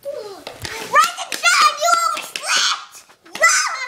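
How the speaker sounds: high, loud, slow singsong wake-up; excited wake-up call. Acted. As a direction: burst in to wake the sleeper. Mom waking child excitedly.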